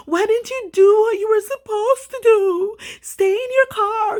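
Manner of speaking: high, loud, preachy know-it-all; mock scolding critics. Mocking those who lecture crime victims, a high scolding voice. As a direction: parody the people who lecture you. Imitating people's scolding advice.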